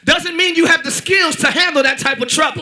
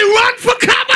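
congregation call-out; 6 semitones higher, 4 dB louder than usual. Acted. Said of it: "Look at somebody, say".

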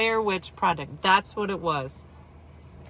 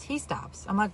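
ordinary delivery

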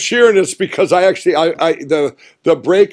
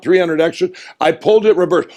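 ordinary talk, nothing marked

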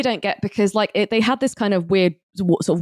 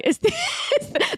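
laughing; 3 dB quieter than usual. Real, not acted. Laughs mid-line.

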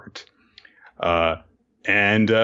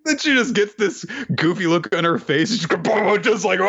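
laughing; 10 semitones higher than usual. Real, not acted. Laughing retelling goofy kick.

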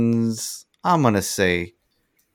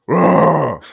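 roar; 7 semitones higher, 9 dB louder than usual. Retold or imitated. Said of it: "like, goes".

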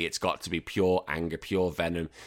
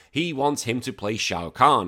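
normal talking voice

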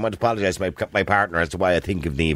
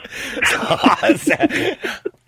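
high laughing burst; 3 dB louder than usual. Real, not acted.

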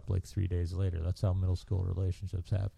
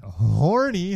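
loud emphatic read; 8 semitones higher, 7 dB louder than usual. Retold or imitated. Repeating the favourite word.

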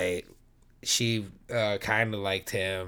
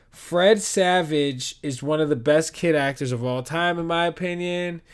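announcer proclamation; 7 semitones higher, 7 dB louder than usual. Pretend. Grand declaration of best actor.

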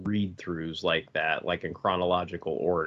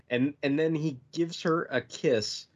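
ordinary talk, nothing marked

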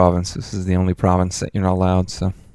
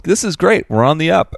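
mock cheerful officials; 8 semitones higher than usual. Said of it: "'cause, like".

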